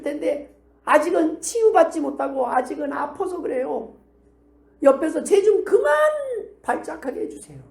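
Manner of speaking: high, anguished pleading; impassioned high-pitched intercession; lamenting prayer. Acted. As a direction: plead with a groaning heart. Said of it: "성령의 탄식과 같은 심정으로".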